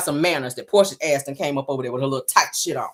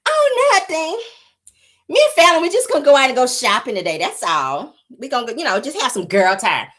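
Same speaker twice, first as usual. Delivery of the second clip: much higher sweet put-on voice; Portia's voice; 5 semitones higher than usual. Mocking Portia answering the husband in a sweet high voice. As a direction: play innocent and sugary. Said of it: "that's Portia".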